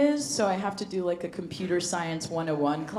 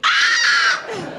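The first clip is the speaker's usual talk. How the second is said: high, loud shriek of horror; mock horror scream; 9 dB louder than usual. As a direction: scream as hands grab you. Horror scream in portal bit.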